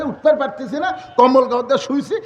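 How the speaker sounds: usual speaking voice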